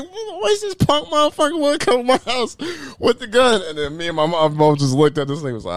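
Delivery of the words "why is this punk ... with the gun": high-pitched mock griping; indignant dad. Dad complaining about armed cop at his house, mock indignant. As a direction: imitate a father complaining, voice way up.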